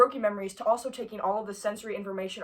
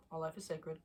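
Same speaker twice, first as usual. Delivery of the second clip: resigned; 5 semitones lower, 14 dB quieter than usual. Real, not acted. Resigned sigh about the bug.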